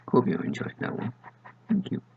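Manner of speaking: hushed, quick closing words; almost whispered thanks; soft aside after the story; soft sign-off. Real, not acted.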